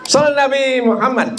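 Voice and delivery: high-pitched call to the congregation; salawat call. Real, not acted. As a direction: call out high for the crowd's response. (seruan selawat bernada tinggi)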